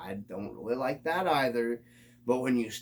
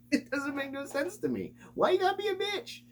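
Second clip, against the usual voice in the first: exasperated high rant. Real, not acted. Vlogger ranting at hobby complainers, pitched way up. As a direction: voice flies up much higher in disbelief.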